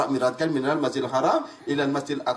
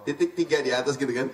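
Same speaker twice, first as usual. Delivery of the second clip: ordinary talk, nothing marked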